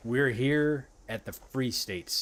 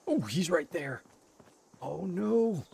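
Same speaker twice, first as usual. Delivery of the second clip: higher frightened yelps; panicking gamer; 9 semitones higher, slower than usual. Real, not acted. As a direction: gasp it out in alarm. Crab still chasing.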